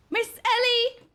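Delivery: high, drawn-out name call. Pretend. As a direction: play at hailing a friend from afar. Calls out to game character.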